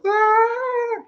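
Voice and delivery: high squealing mock scream; a hammed-up girlish shriek of fright. Disciples mocked as shrieking at a ghost, high squeal. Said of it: "they shrieked and cried like little girls".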